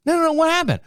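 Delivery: high-pitched mock wife voice, puzzled; imitating wife. Wife questions husband rubbing his back, high mock voice. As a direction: squeak it out like a startled wife. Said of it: "My wife looks at me and goes".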